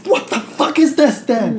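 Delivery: high, loud mock outburst; losing it. Portman losing it over the alien, mock furious yell. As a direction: yell it drawn out, hammed-up outrage. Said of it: "Portman's lost his shit now".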